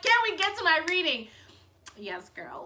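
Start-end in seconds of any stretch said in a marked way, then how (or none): 0.0-1.3 high, loud laughing mock impatience; laughing imitation of an impatient client. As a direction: tease the viewer's hurry. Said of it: "Jasmine's like".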